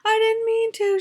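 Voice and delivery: very high, mock-tearful kid's plea; crying child, imitated. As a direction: mimic an upset daughter insisting it was an accident. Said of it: "she's clearly upset and she's crying".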